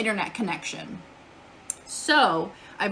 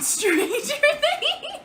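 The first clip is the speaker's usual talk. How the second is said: laughing; much slower than usual. Real, not acted. Laughing at a chat joke.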